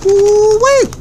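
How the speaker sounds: high squeal of heat; spicy ooh-wee. Real, not acted. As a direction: yelp at the spicy bite. Reacting to spicy chip.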